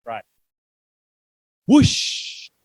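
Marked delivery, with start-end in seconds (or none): 1.7-2.5 breathy whoosh of wings; whooshing sound. Acted. Reading to a child, a loud whoosh. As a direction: blow out a loud rushing whoosh.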